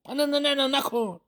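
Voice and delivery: high, panicked no no no; panicked plea. Acted. Shopkeeper panicking as the cup slips, high and frantic. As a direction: cry out to stop the drop.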